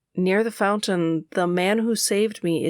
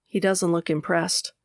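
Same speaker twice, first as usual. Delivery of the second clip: ordinary delivery, nothing marked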